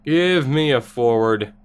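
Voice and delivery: ordinary delivery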